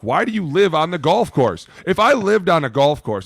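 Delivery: high and loud; exasperated rant. Real, not acted. Ranting to friends about the golf lady, loud and exasperated. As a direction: demand an answer, fed up.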